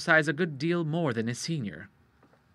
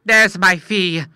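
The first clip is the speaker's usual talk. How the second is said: slow, louder demand; a hammed-up doctor's loud demand; doctor crying out; 6 semitones higher, 12 dB louder, slower than usual. A narrator's reading. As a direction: call out the fee. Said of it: "cried the doctor".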